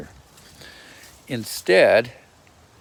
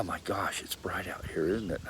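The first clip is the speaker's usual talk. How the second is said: softly whispered aside into the camera; 3 semitones lower, 11 dB quieter than usual. Real, not acted. Vlogger whispers to her friend about the glare. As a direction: hush it like a private remark. Filming outside, hushed aside.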